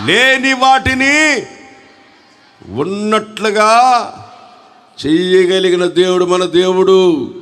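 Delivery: high, long-drawn preacher's shout; loud rallying cry. Real, not acted. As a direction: rouse the congregation with stretched, ringing cries. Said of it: "సాల్దో గట్టిగా హల్లెలూయా!".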